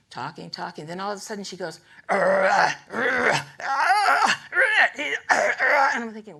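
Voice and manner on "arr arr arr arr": loud, guttural repeated arr; loud imitated chant syllables; imitated chant noises. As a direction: mimic a woman's loud rhythmic throat sounds. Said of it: "the noises she made were".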